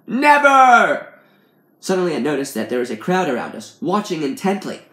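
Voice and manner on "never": very high, loud, drawn-out yell; hammed-up bellow; shouted refusal. A narrator's reading. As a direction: roar it in defiance. Man refuses to stop, shouting.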